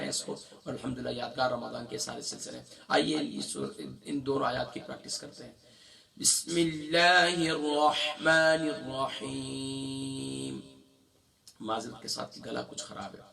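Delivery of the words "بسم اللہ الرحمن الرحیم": slow Quran recitation. Shown as a demonstration. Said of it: "begins a recitation".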